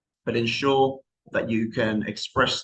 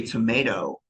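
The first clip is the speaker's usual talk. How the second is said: American accent. Shown as a demonstration. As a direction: say "tomato" like an American, T turned to a light D. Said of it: "in their, in their accent".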